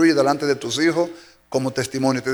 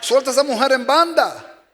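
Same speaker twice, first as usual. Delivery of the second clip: much higher mock voice of a meddling advisor; mocking bad advisor; 8 semitones higher than usual. Pastor mimics a meddling friend urging a wife to leave, much higher mock voice. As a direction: put on a high, taunting gossip voice.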